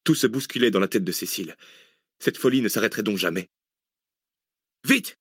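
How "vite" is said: very high, sharp "hurry"; urgent order. A narrator's reading. Zach orders his companions out, urgent command. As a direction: fire off the order, pitch leaping up. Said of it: "ordonna à nouveau Zach".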